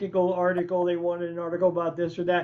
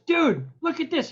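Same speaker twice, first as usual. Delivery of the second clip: high-pitched mock excitement; imitating excited friend; 8 semitones higher than usual. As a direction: burst in eager to show your find. Engineer bursts in waving a paper.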